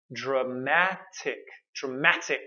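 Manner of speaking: "dramatic" said slowly, syllable by syllable; slow drill. Shown as a demonstration. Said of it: "Our three-syllable word here is dramatic".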